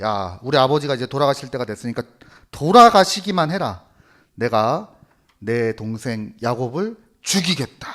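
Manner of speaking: normal talking voice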